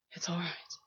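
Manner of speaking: breathy whispered reassurance. A narrator's reading. August whispering comfort into Gwen's ear. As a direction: drop all voice and whisper it close. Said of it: "August said softly".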